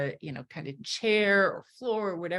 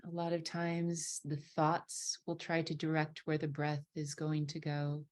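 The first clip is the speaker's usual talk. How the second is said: guided meditation; 5 dB quieter than usual. Acted. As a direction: ease them into their breath, unhurried.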